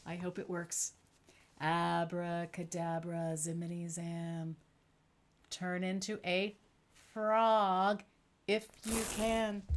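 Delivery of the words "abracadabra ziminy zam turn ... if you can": slow mock-mysterious magician spell; hammy magician for children; kids party magician. Performs magic trick for kids.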